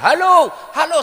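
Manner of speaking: high, loud hellos into the phone; loud confused halo calls; shouting hello. Retold or imitated. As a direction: yell into the wrong end.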